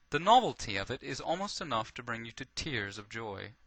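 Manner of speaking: plain reading voice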